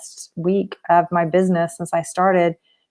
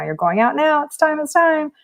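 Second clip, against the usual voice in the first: higher-pitched, insistent hurry-along; urgent nudge; 8 semitones higher than usual. Retold or imitated. The universe pushing her to move now, urgent. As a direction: press it urgently, pitched up high.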